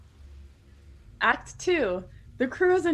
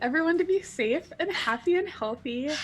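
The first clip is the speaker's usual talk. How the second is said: higher, wide-eyed innocent plea; pleading innocence; 9 semitones higher than usual. Pretend. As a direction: play the innocent, hurt and sincere. Mock-sincere defence of her character.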